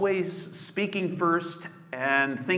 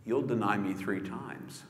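quiet prophecy; 3 semitones lower, 7 dB quieter than usual. Acted. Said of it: "And Jesus says".

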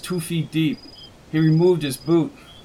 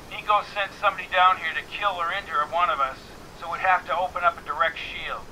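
woman's voice; 3 semitones higher, 6 dB quieter, faster than usual. Acted. Annie's voice, woman character.

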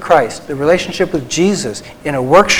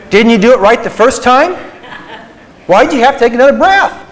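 much higher, louder mock-stern teasing; mock scolding; 6 semitones higher, 3 dB louder than usual. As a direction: play a fussy disappointed teacher for laughs.

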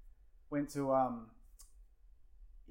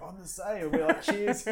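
cheery toast; 8 semitones higher, 8 dB louder than usual. Retold or imitated. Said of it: "And he's like".

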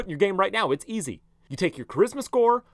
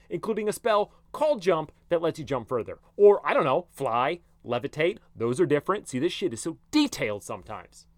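ordinary talk

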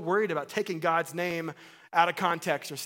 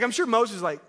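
ordinary delivery

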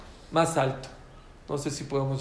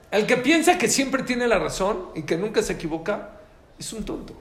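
louder and higher, building to the punchline; emphatic preaching; 6 semitones higher, 4 dB louder than usual. Real, not acted. Rabbi to audience, loud preaching point. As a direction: drive home who the fool is.

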